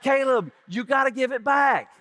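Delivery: loud, high pleading demand; urgent shout. Retold or imitated. Uncle shouting to nephew to return shirt. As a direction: call it across the room, desperate. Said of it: "I'm like".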